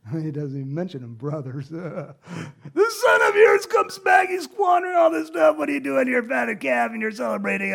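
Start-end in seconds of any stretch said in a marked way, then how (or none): 1.7-7.7 hammed-up, high, loud tantrum; accusing shout. Older son rails about his returned brother, accusing. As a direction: wail the accusation in outrage. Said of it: "he's making accusations about us".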